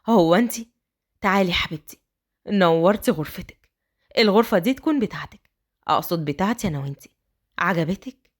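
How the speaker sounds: low tender man's voice; tender husband. A narrator's reading. Raad welcomes bride.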